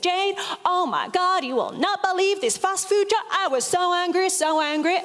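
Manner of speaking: high-pitched put-on American accent, retelling a friend's outrage; terrible American accent. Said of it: "my terrible American accent".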